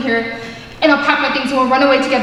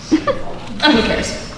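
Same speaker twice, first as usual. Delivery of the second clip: laughing; much slower than usual. Acted. Laughing dismissal of the rival.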